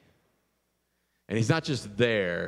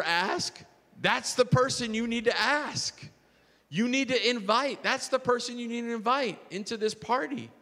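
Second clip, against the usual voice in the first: high, urgent preaching push; preaching at full force; 7 semitones higher than usual. Real, not acted. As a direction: press the challenge home to the church. Sermon climax, urging the congregation.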